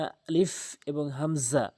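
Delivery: আলিফ এবং হামজা slowly drawn in Arabic letter pronunciation; Arabic letter names. Shown as a demonstration. Teacher shows students how to say আলিফ and হামজা, slow and careful. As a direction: say আলিফ and হামজা slowly, each sound clear. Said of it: "এভাবেই বলবেন".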